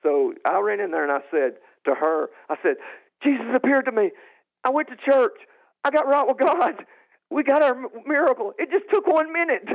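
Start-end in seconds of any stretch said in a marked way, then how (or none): 3.2-9.7 high, breathless burst of joy; reenacted ecstatic testimony, climbing higher; excited proclamation. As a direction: announce each piece of news separately, elated. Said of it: "I became radical for Christ".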